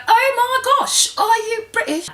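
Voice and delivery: mock British accent, very high loud squeal; mimicked British accent. Excited stranger mimicking her accent on the street, mock British squeal. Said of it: "the need to mimic your accent".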